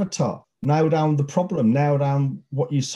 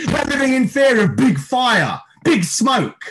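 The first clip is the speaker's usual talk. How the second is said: scornful, higher and louder taunt; sarcastic mock; 8 semitones higher, 4 dB louder, slower than usual. Host ridiculing anti-maskers, sneering higher taunt. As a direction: ridicule them with a sneering lilt.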